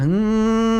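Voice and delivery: high nasal hum; ng sound. Shown as a demonstration. Coach demos the twang ng hum. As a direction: hum it high through the nose. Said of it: "N G sound".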